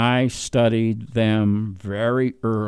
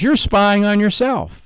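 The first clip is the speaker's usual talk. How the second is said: normal talking voice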